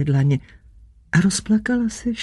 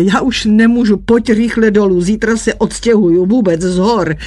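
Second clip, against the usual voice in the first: louder, urgent frantic plea; 2 semitones higher, 4 dB louder than usual. A narrator's reading. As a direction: push it out desperately. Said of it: "řekl zběsile". (zběsile, naléhavě a hlasitěji)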